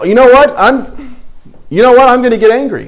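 hammed-up indignation, talking himself into it; mock worked-up anger. Preacher acting out a believer choosing anger, mock indignant.

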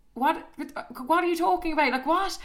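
high-pitched incredulous questioning; baffled disbelief. Retold or imitated. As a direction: question him as if he's talking nonsense. Baffled reaction to his confession.

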